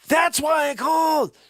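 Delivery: loud, high frustrated burst; high loud irritated exclamation; exasperated shout. Real, not acted. Shouted at union worker.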